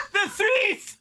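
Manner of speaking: squealed through laughter; laughing. Real, not acted. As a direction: shriek it while cracking up. Laughing at edited photo.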